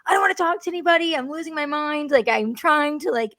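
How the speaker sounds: overwhelmed pregnant mother snapping back at callers, frantic. Retold or imitated. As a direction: sound like you're unraveling. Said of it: "And I'm like".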